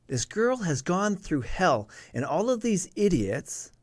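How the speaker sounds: slow, impassioned protest; real indignation. Real, not acted. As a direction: press each point home, pitch swooping up. Indignant about what the girl suffered.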